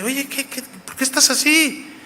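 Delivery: high, soft mock wife's voice, fussing over him; Jezabel's voice. Wife Jezabel asks her pouting king, in a high fussy voice. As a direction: play the wife cooing at her sulking husband.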